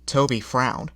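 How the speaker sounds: ordinary delivery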